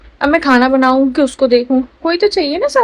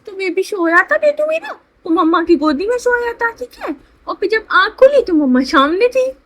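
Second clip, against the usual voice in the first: babbling mother's storytelling voice; 8 semitones higher than usual. Real, not acted. Baby-talk story to infant.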